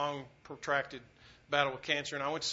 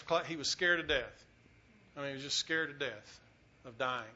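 usual speaking voice

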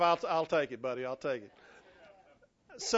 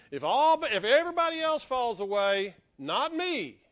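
raised, confident boast as Peter; bold prediction; 4 semitones higher, 4 dB louder than usual. Acted. Peter promising he alone will stay, boldly. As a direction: declare it boldly, voice lifted. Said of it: "he had boldly predicted".

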